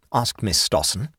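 ordinary delivery

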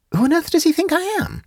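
very high, incredulous question; high-voiced woman's astonishment; astonished. A narrator's reading. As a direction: ask it amazed, voice pitched right up. Jane astonished at being the target.

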